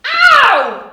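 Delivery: high, loud yelp of pain; vet's yelp. Acted. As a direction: cry out as if bitten. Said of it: "said the vet".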